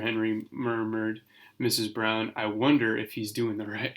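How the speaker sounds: usual speaking voice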